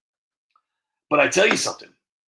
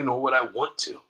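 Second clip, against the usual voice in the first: usual speaking voice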